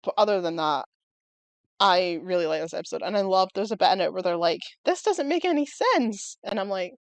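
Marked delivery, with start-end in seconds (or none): 4.9-6.4 high, slow mock-baffled whine; mock protest. Voicing baffled characters in a show, mock protest. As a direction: draw out the complaint up high.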